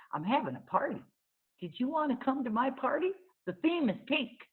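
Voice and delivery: put-on high, wide-eyed small girl asking; high childlike wonder; little girl voice. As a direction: voice her as a curious five-year-old. Child character invites to party.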